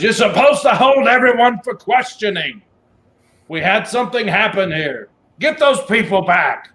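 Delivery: bossy hobgoblin commander; hobgoblin warlord. Acted. Hobgoblin warlord gives orders.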